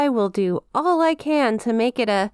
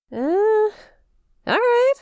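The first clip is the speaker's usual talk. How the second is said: flustered; 13 semitones higher, 3 dB louder, slower than usual. Acted. Demon flustered agreeing to dates.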